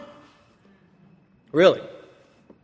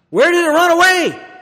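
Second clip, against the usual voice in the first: loud, high preacher's shout; shouted challenge; 11 dB louder than usual. Real, not acted. Preacher challenging believers who lost their faith, shouted challenge. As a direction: cry it out to challenge the congregation.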